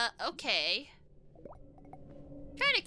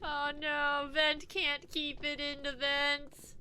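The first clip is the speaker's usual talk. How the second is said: usual speaking voice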